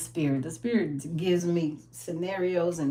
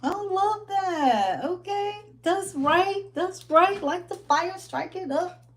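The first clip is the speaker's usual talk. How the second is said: much higher, excited hype-woman cheers; hyped cheering; 9 semitones higher than usual. Real, not acted. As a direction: cheer along like in a dance class. Cheering along to Zumba clip.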